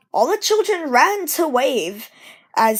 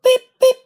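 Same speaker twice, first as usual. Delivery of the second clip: high, loud whistle toots; bright engine whistle; engine whistle beep; 13 semitones higher, 7 dB louder than usual. Acted. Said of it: "whistled Edward".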